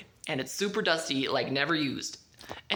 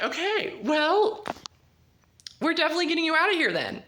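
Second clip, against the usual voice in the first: higher, louder, rushed; urgent resolve; 9 semitones higher, 4 dB louder than usual. Retold or imitated. Friends deciding to break him out, urgent and fast. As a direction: hurry the decision out.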